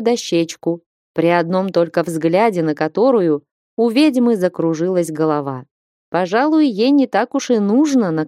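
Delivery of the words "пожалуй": ordinary delivery, nothing marked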